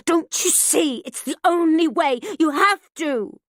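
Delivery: loud, high, driven, slowing at the end; fierce insistence. A narrator's reading. Mother forcing her daughter, fierce. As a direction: force her to see.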